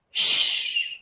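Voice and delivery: soft, unvoiced "shh"; shushing. Shown as a demonstration. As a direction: hush as if for silence. Shushing to show silent E.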